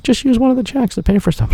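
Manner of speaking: chirpy high imitation of his daughter; mock young daughter. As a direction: play the helpful little girl.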